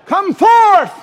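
huge high call to raise the dead; shouted command. Retold or imitated. Jesus at the tomb calling Lazarus out, shouting. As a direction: bellow it out to the tomb. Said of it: "he shouted".

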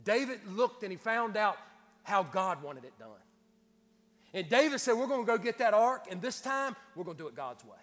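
forceful preaching. Real, not acted. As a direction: drive the story home to the congregation.

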